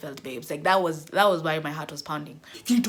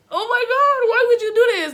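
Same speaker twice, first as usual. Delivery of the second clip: disgusted whine; 9 dB louder than usual. Retold or imitated. Scrubbing the dining hall, disgusted whine. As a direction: whine it at the mess.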